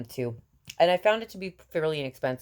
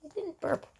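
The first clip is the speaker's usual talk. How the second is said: put-on much higher, softer baby voice; voicing the baby; 14 semitones higher, 5 dB quieter than usual. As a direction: speak for the baby, high and soft.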